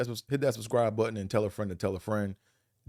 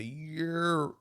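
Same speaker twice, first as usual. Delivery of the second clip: "Year" higher, drawled Philly greeting; 8 semitones higher than usual. Shown as a demonstration. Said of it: "They say".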